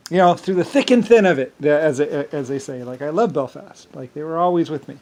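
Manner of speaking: ordinary talk, nothing marked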